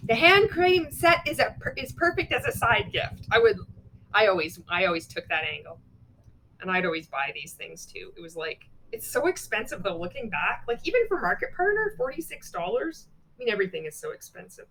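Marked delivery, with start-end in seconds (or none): 0.1-3.3 1 peppy promo read. Shown as a demonstration. Reads a sample post. 10.7-13.1 1 incredulous. Real, not acted. Shocked at the price.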